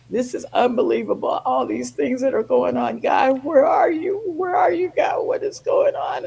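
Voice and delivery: mildly anguished prayer, climbing in pitch; retold crying plea. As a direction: reenact a tearful cry of "where are you". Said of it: "I'm just crying and crying".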